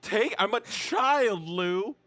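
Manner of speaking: loud, high, drawn-out protest; hammed-up wounded whine; aggrieved child. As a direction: wail indignantly at your rescuer. Injured kid protests to Lou.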